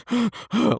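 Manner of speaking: sharp high gasp of sudden panic; panicked gasp. Shown as a demonstration. Surgeon acting out panic mid-operation, sharp gasp. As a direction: suck in air like you've just panicked. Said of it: "like extreme panic".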